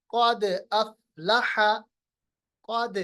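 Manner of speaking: slow क़द अफ़लह, bouncing द; qalqalah on dal. Shown as a demonstration. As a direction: echo the द of क़द. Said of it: "सुकून में लाइए". (क़द में दाल का क़लक़ला)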